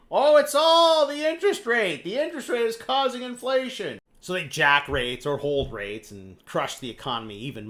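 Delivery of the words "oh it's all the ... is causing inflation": mocking central bankers. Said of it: "Central banks start saying".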